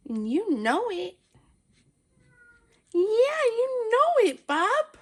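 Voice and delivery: high, singsong fussing over a pet; cooing pet talk. Real, not acted. Owner praising the cat's cutest meow, cooing pet talk. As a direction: coo with long, swooping vowels.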